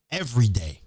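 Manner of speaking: ordinary talk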